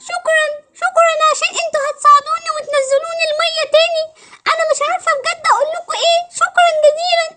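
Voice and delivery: high, whimpering character voice; tearful mermaid. Acted. Mermaid thanks the friends who will help, tearful. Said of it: "ما تعيطيش تاني".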